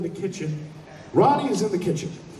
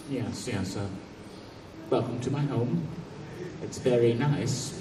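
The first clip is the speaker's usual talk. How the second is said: a hint of a low, softer, formal small man; lightly voiced odd little host; 6 semitones lower, 7 dB quieter than usual. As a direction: play him quietly formal, a touch strange. Odd host Tim welcomes him.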